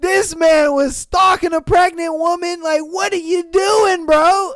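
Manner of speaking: loud and high, real disbelief; outraged shout. Real, not acted. Reacting to a stalker story, real outraged shout.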